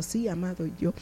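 soft, low reply; retold modest admission. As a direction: own up quietly, voice dropped right down.

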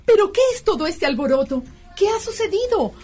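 ordinary talk, nothing marked

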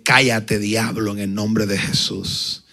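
low, firm rebuke; rebuking the demon in Jesus' name; rebuking the devil. Retold or imitated. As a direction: command the devil to be silent. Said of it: "Yo dije".

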